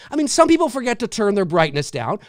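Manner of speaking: ordinary talk, nothing marked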